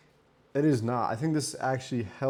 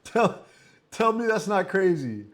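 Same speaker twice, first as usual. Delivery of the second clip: shocked disbelief; 6 semitones higher, 5 dB louder, slower than usual. Real, not acted. Reacting to botched haircut.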